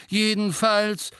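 loud, high, drawn-out resumption. A narrator's reading. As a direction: override the interruption with volume. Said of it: "mit erhobener Stimme". (mit erhobener Stimme, gedehnt)

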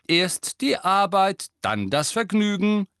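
high, loud, slow and cheerful; chirpy talking planner. A narrator's reading. Talking homework planner hits the wall and speaks, chirpy high voice. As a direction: sing out the motto with jolly relish. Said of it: "wo er frisch vergnügt sagte".

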